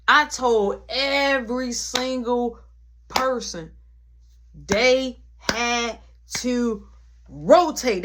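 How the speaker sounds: "every single person" drawn out with pauses; loud, slow "rotate", each word set apart; emphatic word-by-word. Retold or imitated. Stressing that everyone was thrown out.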